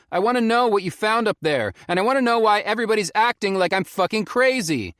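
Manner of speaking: angry demand. A narrator's reading. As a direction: demand answers, fed up. Felix confronting the ranger.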